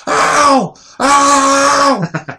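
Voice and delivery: high, loud mock cries of pain; imitated screaming. Scalded mom in shower, imitated pained screams. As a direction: yelp like scalding water hit you. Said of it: "and she's screaming".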